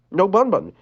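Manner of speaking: ordinary delivery, nothing marked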